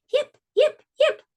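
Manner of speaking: very high, squeaky fox yips; high little fox calling into the night. Acted. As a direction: yelp like a tiny fox calling out. Fox answers the duck.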